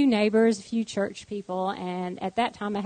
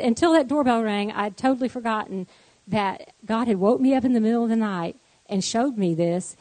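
ordinary talk

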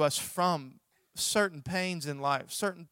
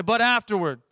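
louder call for the crowd to repeat; congregation prompt; 6 semitones higher, 9 dB louder than usual. Shown as a demonstration. Pastor prompting congregation to repeat, louder call. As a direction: lead them in saying it back.

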